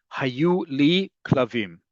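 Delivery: "Hayu li klavim" said at half speed, each word clear; slow Hebrew example phrase; slow Hebrew model. Shown as a demonstration. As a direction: pronounce "Hayu li klavim" slowly for the class. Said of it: "If I want to pluralize".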